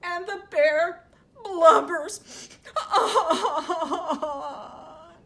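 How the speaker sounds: loud, very drawn-out mock crying; hammed-up storybook wail; wailing, blubbering narration. A narrator's reading. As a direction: sob through each verb, stretching it out. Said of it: "and he wails".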